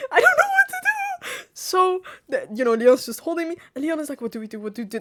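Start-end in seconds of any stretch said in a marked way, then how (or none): 0.1-1.5 very high, tearful whine; squeaky mock-crying voice; mock helplessness. Voicing helpless panic, hammed up. 4.2-5.0 hushed, urgent repeated question; soft mock murmur into an ear. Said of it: "Like, in my ear".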